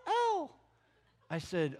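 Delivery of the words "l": very high, loud imitation; real high-pitched voice. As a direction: answer in a piping high voice. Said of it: "in this real high-pitched voice".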